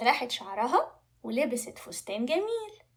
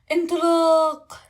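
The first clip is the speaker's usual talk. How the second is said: very loud, drawn-out, higher cry; launch shout; 4 semitones higher, 12 dB louder, much slower than usual. Acted. Dragon flies off for the princess's challenge, shouting. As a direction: bellow the take-off word long.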